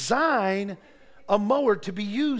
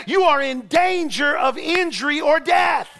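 loud, higher, rising sermon delivery; preaching; 3 semitones higher, 4 dB louder than usual. Real, not acted. Preacher urging the congregation to live in God's presence, preaching hard. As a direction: preach it with full force.

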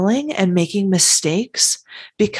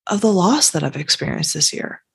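normal talking voice

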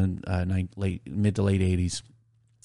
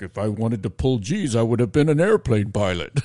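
lightly voiced grumpy dad, pitched up at the end; curmudgeon dad; 2 semitones higher, 3 dB louder than usual. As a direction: imitate a dad's cranky stock retort. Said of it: "he was a curmudgeon".

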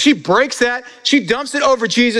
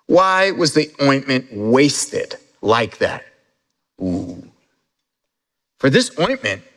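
scripture reading; 5 semitones lower than usual. A narrator's reading. Slow hushed scripture reading.

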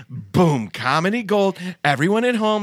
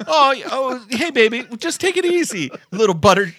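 mock soothing husband; 7 semitones higher than usual. Voicing husband soothing his wife.

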